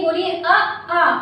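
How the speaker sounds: अ, आ said very slowly; teacher slowly models अ and आ for practice; slow vowel demo. Shown as a demonstration. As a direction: stretch अ and आ slowly, hand on throat. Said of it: "हाथ रखिए और बोल कर देखिए".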